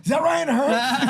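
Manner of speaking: high, loud, drawn-out question; hammed-up astonished cry; starstruck disbelief. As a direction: imitate an amazed onlooker spotting a celebrity. Retold guest astonished at seeing Ryan.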